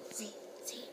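quiet whispered prompt. Real, not acted. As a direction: whisper it aside. Whispered aside to child.

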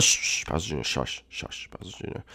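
hushing himself into a whisper; voice kept down. Pretend. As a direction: shush yourself, sinking to barely audible. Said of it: "I gotta get my voice down".

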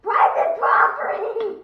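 high, very loud, drawn-out shout; territorial yell. Real, not acted. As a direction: bellow it to chase off an intruder. Defending house from intruder.

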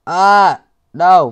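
normal talking voice

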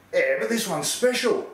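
high-pitched, insistent sales voice; pushy salesman. Acted. Shoe seller pitching to Uncle Barry, high-pitched and insistent. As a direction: pitch it up and push the deal.

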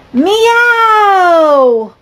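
high, loud, long meow; loud cat meow. Acted. The cats answer the narrator, loud high meow. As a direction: belt out a big cat meow.

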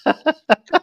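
sudden high burst of laughter; loud laugh. Real, not acted. Laughs mid argument.

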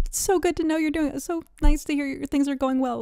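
gushing well-wisher. Retold or imitated. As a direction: rush out mock-cheery pleasantries. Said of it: "like, him saying".

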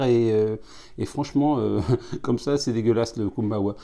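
talking into a glass; muffled by glass rim; speaking with glass at lips. Real, not acted. Seen 3: Small tasting glass held at or against lips throughout speech.

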